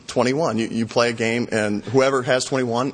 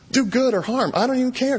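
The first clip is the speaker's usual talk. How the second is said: higher, fed-up challenge; exasperated taunt; 10 semitones higher than usual. Acted. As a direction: dare them, you've lost patience. God taunting the idols.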